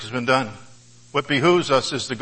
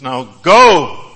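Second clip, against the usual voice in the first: loud dismissal; 10 dB louder than usual. Acted. Quoting the dismissal.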